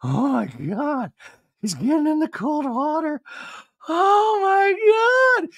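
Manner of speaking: high, drawn-out mock swoon; hammed-up thrilled wail; titillated. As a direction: gush in a squeaky, stretched-out falsetto. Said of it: "lights up like a Christmas tree".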